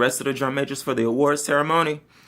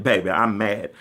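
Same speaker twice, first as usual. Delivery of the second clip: usual speaking voice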